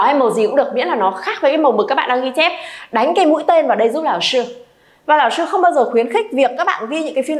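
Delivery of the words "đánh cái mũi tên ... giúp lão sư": ordinary delivery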